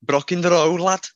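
mock Birmingham yob; Brummie accent. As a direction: say it like an angry Brummie. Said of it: "Brummie's more like that".

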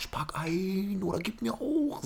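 high, slow whining teacher voice; put-on high nagging; scolding teacher. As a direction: mimic a teacher's drawn-out squeaky order. Imitates teacher scolding hungry pupils.

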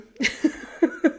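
airy unvoiced chuckle; breathy silent laugh. Real, not acted. Laughs at own joke.

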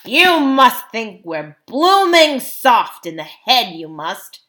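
loud, slow, indignant dwarf; gruff dwarf refusing to be fooled; scornful dwarf. A narrator's reading. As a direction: lean hard on the scorn.

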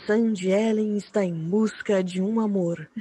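low, slow sultry announcer voice; sultry narrator. Pretend. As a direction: purr it like a seductive dating ad. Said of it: "Grava com aquela voz sensual".

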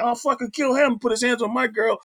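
high-pitched mock outrage; worked-up retold threat, pitched high; teenage bravado threat. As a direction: puff up like an indignant kid. Voices his young self threatening her dad.

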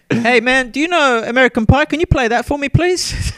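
high cartoonish plea to the DJ; mock request. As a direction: ham up asking the DJ for a song. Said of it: "I didn't use the American Pie".

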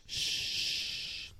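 soft unvoiced shh with a hand wave; hushing shh. Imitating the teacher shushing the class. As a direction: shush them like a strict teacher. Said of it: "with the hands as well".

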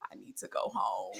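breathy near-whisper, barely voiced; drained whisper. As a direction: whisper it like you're fading fast. Drunk friend quietly says she's leaving.

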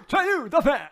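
high, loud cartoon announcer; laughing, over-the-top pitchman; Duff Man. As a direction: boom it out like a cartoon mascot. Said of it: "You sound like Troy McClure from The Simpsons or Duff Man".

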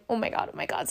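hushed panic. Retold or imitated. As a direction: say it under your breath, retelling a panic. Retold private panic in back seat.

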